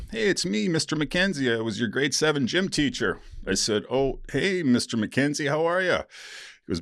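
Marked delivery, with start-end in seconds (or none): 0.1-3.2 higher-pitched, chirpy self-introduction; friendly gym teacher. Retold or imitated. Voicing old teacher driving ambulance. 3.9-6.0 higher-pitched, lightly acted hello; mock friendly greeting. Acting own greeting to old teacher.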